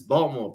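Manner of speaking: "Baltimore" squeezed to two syllables, no T; dropped T. Shown as a demonstration. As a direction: say "Baltimore" locally, skipping the T. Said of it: "there's no T in it".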